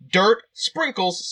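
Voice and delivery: usual speaking voice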